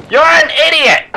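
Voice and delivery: put-on very high, squealed kid insult; berating kid. Kid berating his mom in the store, high mock tantrum. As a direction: shoot the pitch up as a mock bratty kid. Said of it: "continued to just berate and publicly shame".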